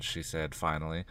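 plain reading voice

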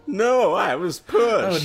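put-on high-pitched teen girl voice, loud. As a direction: play Lisa in a high girlish voice. Lisa voiced in read story.

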